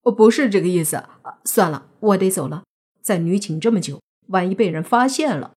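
plain reading voice

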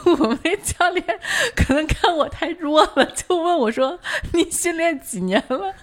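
high-pitched, laughing through the words; amused, giggly retelling. Real, not acted. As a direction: tell it on yourself, barely holding back laughter. Tells own embarrassing story laughing.

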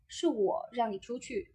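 我 stressed and set apart. Shown as a demonstration. Voice coach showing that I am the one ordering, stress on 我. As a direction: put the weight on 我. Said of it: "如果把重音放在 “我".

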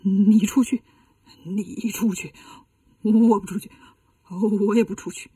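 low, quavering back-and-forth of two monks; scared bickering. Acted. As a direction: pass the buck timidly, dragging each first word. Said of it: "俩人都不敢出去". (胆怯地互相推诿)

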